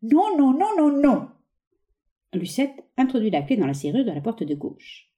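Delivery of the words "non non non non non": high, dug-in string of nos; stubborn refusal. Acted. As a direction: refuse to budge. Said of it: "décidé à ne pas bouger".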